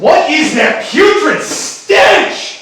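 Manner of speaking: loud, high, drawn-out revulsion; disgusted outcry. Acted. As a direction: cry out slowly in theatrical disgust. Acted disgust at a bad smell.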